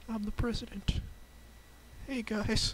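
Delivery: high, shy little voice; bashful Samuel L. Jackson. Pretend. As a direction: say it timidly, way up high. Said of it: "Sammy, you sound very bashful".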